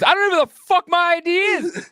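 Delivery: squeaky exasperated yelling; angry traveler. Retold or imitated. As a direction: rant like a furious passenger who's had enough. Angry man retold.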